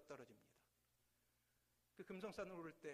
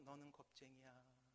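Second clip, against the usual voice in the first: much softer distant echo of the insult; faint echo; 4 semitones lower, 11 dB quieter than usual. Acted. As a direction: let it come back from far away. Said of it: "저 멀리서". (멀리서 돌아오는 메아리)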